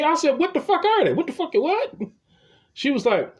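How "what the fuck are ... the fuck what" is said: much higher, baffled demand; exasperated confusion. Retold or imitated. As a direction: push back, totally lost. Arguing with counter clerk.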